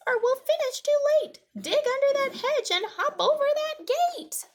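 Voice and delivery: very high, loud squeaky mouse; Marvin. A narrator's reading. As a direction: squeak out urgent orders in a tiny mouse voice. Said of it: "Marvin squeaks".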